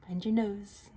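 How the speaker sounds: normal talking voice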